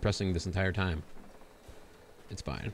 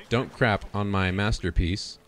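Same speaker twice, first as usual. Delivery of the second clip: slow, deliberate haiku recital; slower than usual. Pretend. Reading his silly haiku aloud, slow and deliberate. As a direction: savour each word of the poem.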